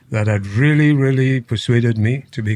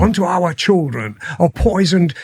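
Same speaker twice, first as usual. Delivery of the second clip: higher-pitched, imitated Queen's English; Prince Charles's accent; 6 semitones higher than usual. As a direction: quote the speech in a put-on royal British accent. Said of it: "He said".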